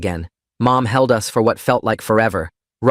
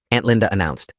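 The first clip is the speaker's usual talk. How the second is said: usual speaking voice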